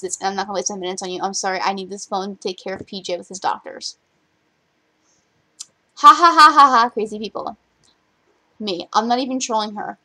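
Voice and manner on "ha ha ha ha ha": loud, high jeering ha ha ha; mocking laugh. Real, not acted. Vlogger jeering at rivals with a mocking laugh. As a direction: laugh out loud at the crazy people.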